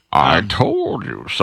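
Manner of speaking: slow, low put-on villain voice; comic drawn-out villain impression; Bane. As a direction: drag it out as a gloating masked villain. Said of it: "Nothing worse than Bane telling you".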